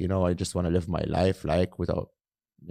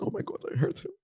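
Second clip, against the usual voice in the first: breathy guilt-stricken whisper; guilty whisper; 7 semitones higher, 5 dB quieter, much faster than usual. Retold or imitated. [name] retelling his guilt after hurting the bully, whispered. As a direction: whisper it like you just realised. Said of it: "I was like".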